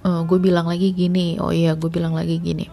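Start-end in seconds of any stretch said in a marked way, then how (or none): none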